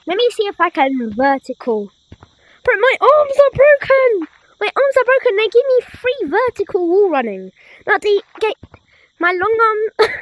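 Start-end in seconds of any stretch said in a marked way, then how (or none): 2.6-7.5 high excited yelling. Real, not acted. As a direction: blurt it out in delight. Gaming excited exclamation.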